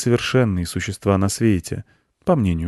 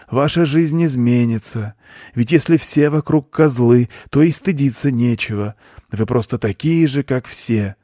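raised, earnest lecturer's voice; preaching goat; 6 semitones higher, 4 dB louder than usual. A narrator's reading. Goat explains his doctrine to a boy, preaching. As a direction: expound it like a guru, pitched up.

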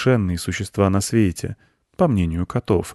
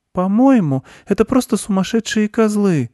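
put-on high-pitched little girl voice; girl's voice; 5 dB louder than usual. A narrator's reading. Girl giving her opinion of the goats, high girl's voice. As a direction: pipe up as a girl, much higher. Girl character's line in story.

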